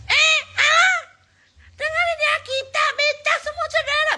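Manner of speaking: very high, strained mimicry with no authority; mocking squeaky voice. Host mimics a rival addressing a crowd, shrill mock voice. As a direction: squeal the speech like a hopeless leader. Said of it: "Wibawanya suara".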